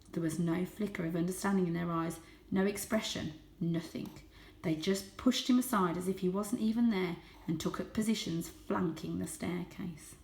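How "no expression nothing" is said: usual speaking voice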